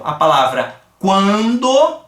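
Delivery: "quando" drawn out, with a nasal an; "quando" lento e nasal; slow demo word. Shown as a demonstration. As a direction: stretch "quando" and hum the an. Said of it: "Olha só que interessante aqui".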